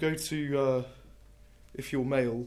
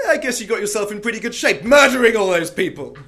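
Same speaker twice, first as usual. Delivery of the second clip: higher, louder mocking tease; teasing; 7 semitones higher, 13 dB louder than usual. Poet mocking the murderer after badminton, loud tease. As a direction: needle him with fake cheer.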